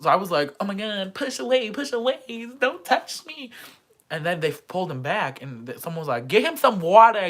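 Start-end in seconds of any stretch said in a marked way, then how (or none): 0.6-3.5 high, rising panicky push away; panicked protest. Retold or imitated. Pushing off a drunk guy, high panicked protest. 6.3-7.3 loud urgent call for water. Retold or imitated. A friend calling for water for a drunk guy, loud. Someone calls out.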